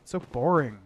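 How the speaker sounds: lightly mocked LA accent. Streamer imitating a contestant from LA, lightly mocked LA accent. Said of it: "You're like, "I'm from L A".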